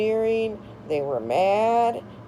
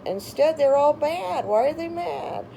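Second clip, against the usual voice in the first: indignant outburst; 10 semitones higher than usual. Real, not acted. Indignant rant about neighbors.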